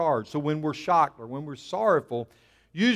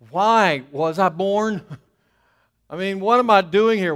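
louder, higher mock complaint; exasperated lament; 3 semitones higher, 6 dB louder than usual. Pastor acts out Jeremiah questioning his birth, exasperated lament. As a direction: wail it a little, half laughing.

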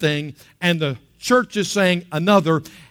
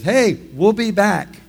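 loud, drawn-out holler from a departing boat; breezy shout to someone left behind; calling out; 5 semitones higher, 6 dB louder, slower than usual. Retold or imitated. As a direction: call back higher and slower. Boat friends calling to him.

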